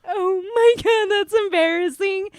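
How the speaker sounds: high, loud mortified reaction; retold embarrassment. Driver reliving her gas station blunder, embarrassed. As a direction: reenact being mortified, pitch and volume up. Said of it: "major embarrassment".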